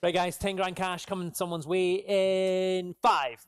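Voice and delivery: slow, high, loud hype; excited announcer. Real, not acted. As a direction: build the countdown up big. Hyping the cash draw countdown.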